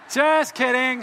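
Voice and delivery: loud, high jokey exclamation; emphatic playful retraction. Real, not acted. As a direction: belt out the joke disclaimer. Repeats the joke retraction louder.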